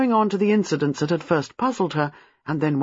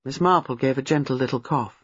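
ordinary delivery

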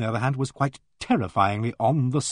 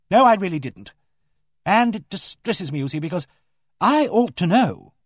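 high, earnest protest, surging louder on key words; troubled, emphatic vicar's wife; 6 semitones higher, 4 dB louder than usual. Acted. Vicar's wife puzzling over who would do this, earnestly distressed. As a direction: insist on it, distressed at not knowing.

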